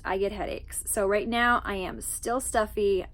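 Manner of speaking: ordinary talk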